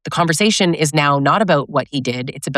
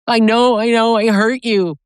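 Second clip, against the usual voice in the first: mocking hollow apology; 6 semitones higher than usual. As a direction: toss off a hollow sorry.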